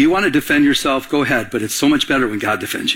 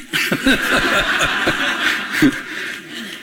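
amused laugh after a joke; 5 semitones higher than usual. Real, not acted. Pastor amused at cheering on his defenders, laughing. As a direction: laugh it off, trailing away.

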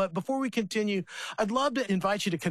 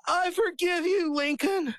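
put-on higher, sweet child's forgiveness; little girl voice; 12 semitones higher than usual. Father retelling his daughter forgiving her brother, higher child voice. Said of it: "and she says".

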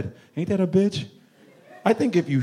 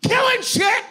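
high whale shout; 12 semitones higher than usual. Pretend. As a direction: scream it out like a free whale.

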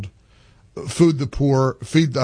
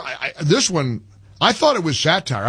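normal talking voice

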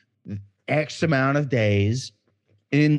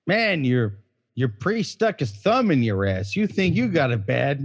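ordinary delivery

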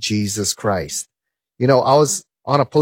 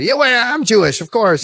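mock huffy professor; indignant insistence; 9 semitones higher than usual. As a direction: retort as if insulted.

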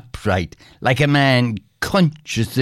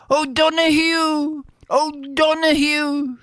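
calling out. A narrator's reading. Bill hailing his benefactor far off on the lake, calling out. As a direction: call after him in a high sustained voice. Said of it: "shouted Bill".

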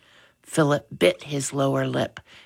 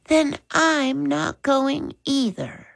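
usual speaking voice

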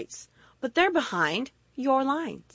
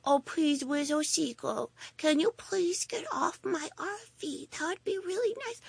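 much softer, meek pleading plea; timid polite asking; 4 semitones higher, 10 dB quieter than usual. Retold or imitated. Timid believer politely begging the seagull off, soft meek. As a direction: ask the bird nicely, almost apologizing. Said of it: "you just go up to the seagull".

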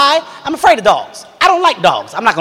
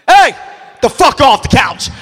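mock tough order; 6 semitones higher, 4 dB louder, slower than usual. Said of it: "starts off tough".

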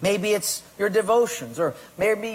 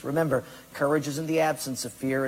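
ordinary talk, nothing marked

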